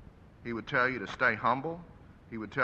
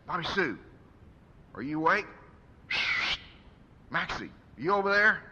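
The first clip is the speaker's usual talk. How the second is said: a lightly voiced father calling out, higher; 6 semitones higher than usual. Father wakes and calls to his family, lightly voiced, higher. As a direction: call across the dark room, rising.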